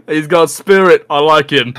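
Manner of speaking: high, amused monster voice. Acted. Gray monster approving of the new recruit, high and amused. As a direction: approve of him with a laugh in the voice. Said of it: "the gray guy go".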